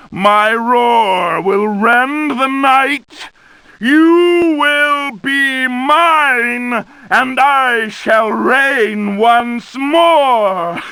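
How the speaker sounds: villain declaring his return; rising villain roar. Acted. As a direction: build to a booming proclamation, slowing on you will be mine. Villain's climactic threat.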